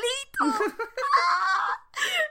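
high shrieking laugh of delight; a squealing fan laugh; delighted laughing scream. Real, not acted. Fangirl scream at scene.

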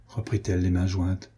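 usual speaking voice

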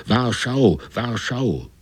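two high cries, the second falling away; shouting. Acted. The crew crying out as the wave rises, high shouts. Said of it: "Jetzt schrien alle".

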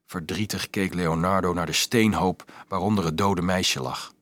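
usual speaking voice

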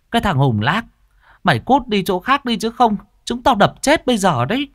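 high, threatening yell; irate shout, pitch shooting up; angry scolding. A narrator's reading. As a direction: chase off a nuisance with threats. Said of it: "Bị quát mắng".

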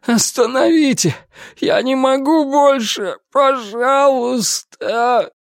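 frantic begging. Acted. Tortured prisoner begging.

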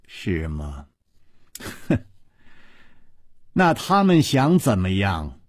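a chilling little laugh of contempt; cold sneer. A narrator's reading. Palace mistress mocking the intruders with a low cold sneer. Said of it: "水母阴姬冷笑着说".

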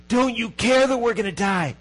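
terrified disciples shouting over the storm; panicked plea. Retold or imitated. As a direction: beg him to wake up, scared. Disciples waking Jesus in storm.

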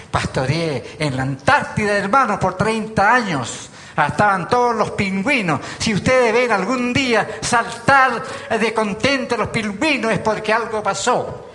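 lively preacher's banter, voice lifted; excited joking. Real, not acted. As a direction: ham up the penguin joke with a grin. Joking about pastoring penguins.

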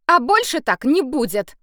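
high, loud stern order; firm and stern. A narrator's reading. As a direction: lay down the law. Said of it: "жёстко произнесла Саша". (жёстко, твёрдо)

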